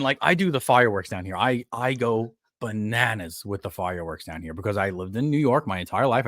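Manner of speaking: ordinary talk, nothing marked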